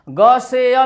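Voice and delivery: ग से या drawn out as "gaa-say-yaa"; wrong-example long "gaa-say-yaa" for ग से या; majhool vowel. Shown as a demonstration. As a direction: stretch ग से या with a long "ay". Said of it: "नहीं बोलना है आपको".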